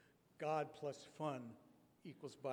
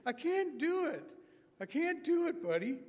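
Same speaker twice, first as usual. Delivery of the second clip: much higher, louder, pleading refusal; Robert's high voice; 12 semitones higher, 9 dB louder than usual. Retold or imitated. Preacher voicing Robert turning down kickball, high and pleading. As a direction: squeak out the refusal shyly. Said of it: "He'd say".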